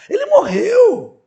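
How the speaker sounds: high, slow, shouted verdict; emphatic shout. Real, not acted. Preacher shouting down the objector's gymnastics, high and slow. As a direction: slam the point home.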